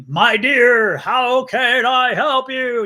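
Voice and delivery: grand, drawn-out theatrical greeting, high and loud; slow, hammed-up TV-witch voice pitched high; Endora from Bewitched. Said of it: "like Endora from Bewitched".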